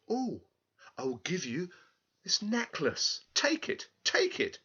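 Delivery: high, breathless eager offer; eager girl. Acted. Girl eagerly hands the little man her necklace, high. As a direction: press the necklace on him. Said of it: "she replied".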